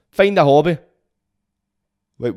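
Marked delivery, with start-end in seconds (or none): 0.1-0.8 loud mock advice.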